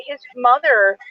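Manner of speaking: normal talking voice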